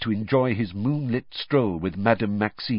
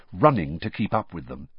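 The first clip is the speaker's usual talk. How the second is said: ordinary delivery, nothing marked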